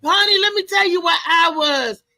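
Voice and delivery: playful mock delight on Monday morning; imitating a happy coworker. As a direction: gush like a coworker back from a great show. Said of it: "you'll be saying".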